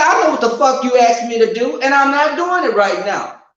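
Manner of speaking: high, loud retold refusal; heated, indignant comeback; defiant snapping.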